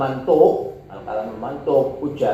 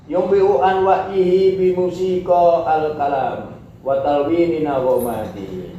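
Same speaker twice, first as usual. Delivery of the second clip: raised, louder recitation of the Arabic; Arabic text reading; 5 semitones higher, 4 dB louder than usual. Acted. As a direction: read the Arabic passage out formally. Reads Arabic text aloud.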